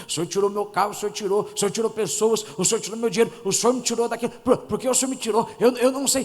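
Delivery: mock pleading prayer, speeding up and rising; religious believer's prayer. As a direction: lightly play a whiny churchgoer begging God. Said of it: "aquelas oração de crente carnal religioso". (oração chorosa de crente religioso, imitada)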